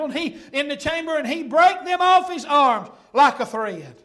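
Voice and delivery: loud pulpit reading with surging emphasis; forceful scripture preaching. Acted. Preacher reading Samson's story to the church, loud preaching. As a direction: belt out the key phrases like a preacher.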